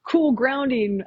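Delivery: mild mock of a gushing presenter; mocking the host. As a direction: parody an overexcited workshop host. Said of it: "the woman was like".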